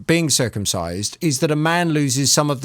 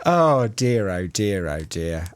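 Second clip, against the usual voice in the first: resigned oh dear; 7 semitones lower, slower than usual. Real, not acted. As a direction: let each oh dear sink away. Host reacts to guest's answer.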